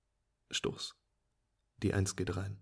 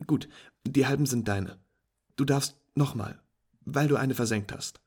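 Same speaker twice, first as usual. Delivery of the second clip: higher, louder pleased voice; cheerful friend; 4 semitones higher, 8 dB louder than usual. Acted. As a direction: praise the beginner's lucky shot.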